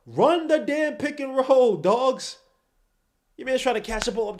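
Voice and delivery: loud, slow, fed-up yell; exasperated shout. Real, not acted. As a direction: yell it at the screen. Frustrated fan yelling at team.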